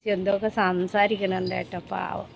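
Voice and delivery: usual speaking voice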